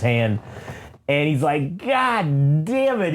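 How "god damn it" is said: drawn-out, higher agonized curse; pained cursing. Retold or imitated. Retelling the broken-hand punch, hammed-up pained cursing. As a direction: stretch the curse out in exaggerated pain.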